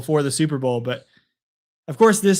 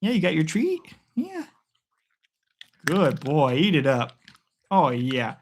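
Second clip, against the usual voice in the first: praising the dog. Real, not acted. Praising dog eating treat.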